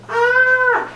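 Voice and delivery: very high yelp of horror; mock pained scream. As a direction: squeal like a horrified sound engineer. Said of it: "sitting there on the mixing desk going".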